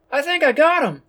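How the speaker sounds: high, loud call across the room. A narrator's reading. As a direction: call out to the boss. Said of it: "calls out one of his men".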